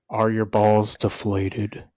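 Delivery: slow, sultry put-on sexy voice. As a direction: purr it out slowly to tease. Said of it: "I had to talk in this sexy voice".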